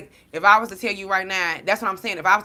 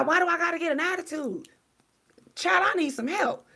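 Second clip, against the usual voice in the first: high, exasperated whine; 12 semitones higher than usual. Real, not acted. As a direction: throw it up high like you can't believe yourself. Exasperated rant about being corrected.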